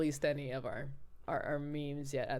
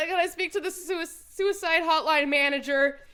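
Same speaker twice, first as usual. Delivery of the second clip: higher, louder indignant demand for the manager; mock-outraged, still giggling; pissed off demand; 11 semitones higher, 7 dB louder than usual. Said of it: "I was just pissed".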